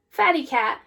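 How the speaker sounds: ordinary delivery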